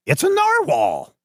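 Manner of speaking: high, slow delighted announcement; Doctor West. Acted. Doctor West identifying the creature, high and slow. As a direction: savor the word in a high voice. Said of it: "he's like".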